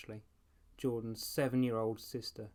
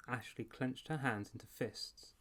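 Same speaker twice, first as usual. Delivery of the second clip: ordinary delivery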